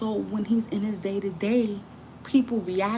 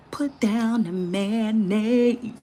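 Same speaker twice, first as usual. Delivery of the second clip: imitating him; 5 semitones higher, 4 dB louder, much slower than usual. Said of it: "he was like".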